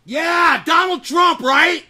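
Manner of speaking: loud, high answer shout; triumphant shout. Real, not acted. Host shouting out the answer to his own question. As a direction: bellow the answer home.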